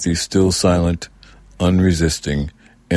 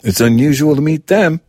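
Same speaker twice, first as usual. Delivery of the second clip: put-on high, loud child's voice; stubborn little girl insisting; childish insistence; 10 semitones higher, 5 dB louder than usual. A narrator's reading. Girl defending herself to her mother, stubborn.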